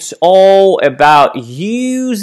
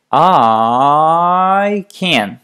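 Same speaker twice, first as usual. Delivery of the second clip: one finger per syllable, launched deliberately. Shown as a demonstration. Coach demonstrating finger-tapped speech to a student. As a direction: tap each syllable out with the thumb. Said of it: "so we say".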